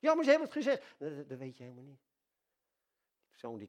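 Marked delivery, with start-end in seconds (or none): 0.0-1.0 high, loud worried objector voice; bezorgde tegenwerper. Acted. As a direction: protest like an anxious listener. Imagined objector protests. (hoge, luide bezorgde tegenwerping)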